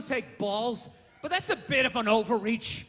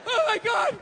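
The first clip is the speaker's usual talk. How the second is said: exasperated yell; 9 semitones higher than usual. Real, not acted. Exasperated shouting.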